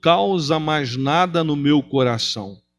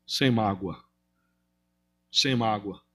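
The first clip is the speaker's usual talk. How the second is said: soft repeat; 5 semitones lower, 6 dB quieter, slower than usual. Acted. Preacher softly repeats answer.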